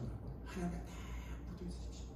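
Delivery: dropped to a near whisper; hushed plea. Real, not acted. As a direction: urge it softly, almost breathless. Preacher urging, drops to whisper.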